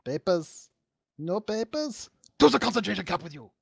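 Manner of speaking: high, fast mock German officer bark; German accent. Mock Nazi guard at friends, German accent. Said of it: "I don't think I have a good German accent".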